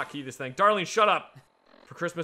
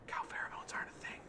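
whispering; 16 dB quieter, faster than usual. Real, not acted. Secret aside to viewers.

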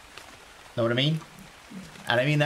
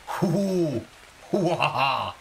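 hammed-up drawn-out hoo-ha-ha; playful exaggerated hooting; theatrical mock laugh; 3 semitones higher, much slower than usual. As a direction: fake a big hooting laugh. Mock reaction to pets question.

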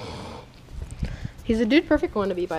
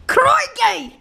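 much higher hammed-up Aussie crikey; mock Australian crikey; 13 semitones higher, 3 dB louder than usual. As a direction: shout it like a fake croc hunter. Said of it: "I'll talk with my normal accent".

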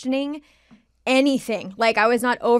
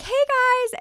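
much higher, cheery hey guys; 1 breezy greeting. Retold or imitated. Walking in on his friends, a high cheery hello. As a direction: sing it out brightly to the room.

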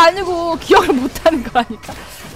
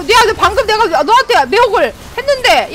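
normal talking voice

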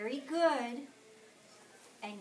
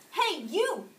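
higher, louder brash hail; grouchy call-out; 8 semitones higher, 9 dB louder than usual. A narrator's reading. Grouchy ladybug hails the yellow jacket, loud and brash. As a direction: call out a challenger rudely. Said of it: "said the grouchy ladybug".

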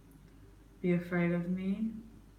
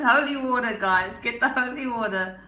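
louder, higher, hammed-up cry for holy water; mock panic call; 4 semitones higher, 6 dB louder than usual. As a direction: call out as if a demon's in the room.

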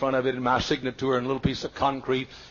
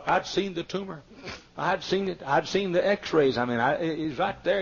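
ordinary talk

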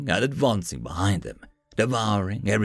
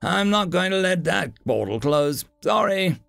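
clenched teeth; 7 semitones higher than usual. A narrator's reading. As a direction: talk through clenched teeth. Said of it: "these teeth still clench".

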